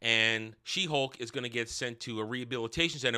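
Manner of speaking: ordinary delivery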